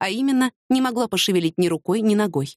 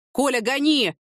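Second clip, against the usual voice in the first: higher-pitched, contained command; urgent order; 5 semitones higher, slower than usual. A narrator's reading. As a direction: press the driver to go now. Urgent order under gunfire. (настойчивый приказ, повышенным тоном)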